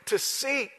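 "To seek" pitched very high, with seek held out; stress on seek. Shown as a demonstration. Preacher answering his own question, high stress on "seek". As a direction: punch "seek" as the key word.

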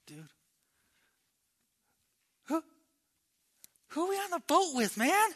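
high, hesitant, spooked stammer; halting start, then high-pitched disbelief; afraid. Acted. Disciples huddled in the corner of the boat, afraid. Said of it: "They're afraid".

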